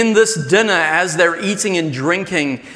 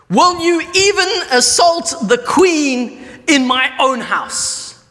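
much higher, louder accusing cry; outraged king; 9 semitones higher, 4 dB louder, slower than usual. Acted. King catching Haman with the queen, outraged. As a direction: hurl the accusation at him.

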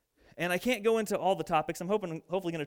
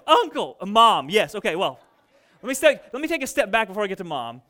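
normal talking voice

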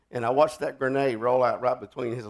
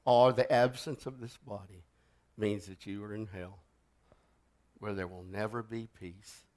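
solemn altar call; 6 semitones lower, 9 dB quieter than usual. Acted. Preacher closing the sermon to the congregation, hushed pleading. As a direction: lean in and plead quietly.

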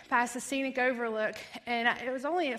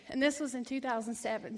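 ordinary delivery

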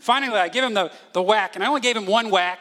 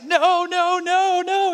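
high, loud mock wailing; crying, screaming child; 11 semitones higher, 6 dB louder, much slower than usual. Dad imitating his son begging before a spanking, crying and screaming. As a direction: imitate a hysterical little boy pleading at full pitch. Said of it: "He's crying, he's screaming".

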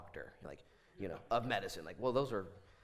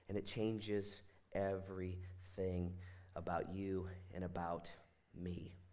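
slow, low, soft, spaced-out words; hushed, deliberate pulpit close; solemn emphasis; 4 semitones lower, 7 dB quieter, slower than usual. Real, not acted. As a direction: let each word land quietly.

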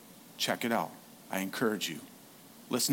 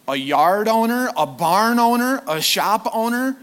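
mounting exasperation; 8 semitones higher, 6 dB louder than usual. Retold or imitated. List of roles building up.

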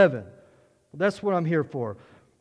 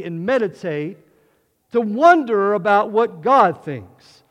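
louder, rising preacher's build to full force; fervent preaching; 2 semitones higher, 6 dB louder than usual. Acted. Pastor stirring the congregation about God's word, fervent preaching.

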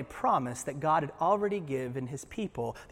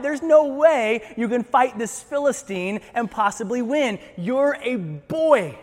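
louder, higher acted incredulity; exasperated rebuke, slowing hard at the end; dismissive king; 6 semitones higher, 5 dB louder than usual. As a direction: shut the youngster down emphatically. Acting out Saul dismissing David.

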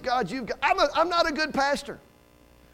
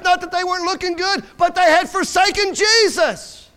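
high, driving pulpit delivery; impassioned preacher building to a punch; forceful preaching; 6 semitones higher, 4 dB louder than usual. Real, not acted. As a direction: hammer each clause, stretching the key words.

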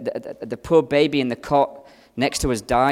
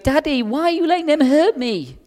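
put-on much higher pleading child's voice; little daughter; 12 semitones higher than usual. Father voicing his screaming daughter to the congregation, high child plea. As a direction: cry out like a hurt toddler, far up in pitch. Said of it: "She's going".

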